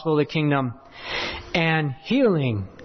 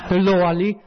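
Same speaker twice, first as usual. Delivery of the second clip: louder imitated West African accent hello; Burkina Faso accent; 5 dB louder than usual. Preacher mimicking his African neighbor at the door, accented. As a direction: repeat the greeting in a fond Burkina Faso accent impression. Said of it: "I love his accent".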